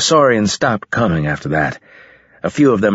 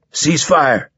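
mild shout, pitched up; narrator's contained, higher-pitched shout; shouted order; 6 semitones higher than usual. A narrator's reading. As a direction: call out a command to the troops. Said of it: "he shouted".

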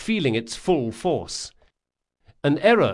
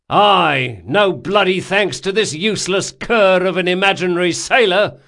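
high, loud grumbling abuse; angry outburst; 7 semitones higher, 8 dB louder than usual. A narrator's reading. Captain abusing an imaginary sailor, angry shout. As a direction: blame the useless sailor for all to hear.

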